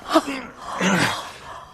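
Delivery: sharp high gasp of horror; shocked gasp. Acted. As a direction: gasp as if struck by the news. Gasp on hearing the murder.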